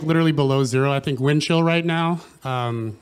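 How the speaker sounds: ordinary delivery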